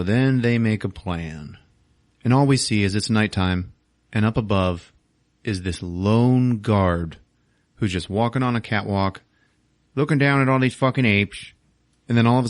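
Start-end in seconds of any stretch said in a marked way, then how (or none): none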